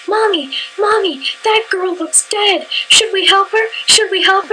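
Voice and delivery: put-on high-pitched little boy pleading to mom; child tugging at mom for attention. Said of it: "this little boy".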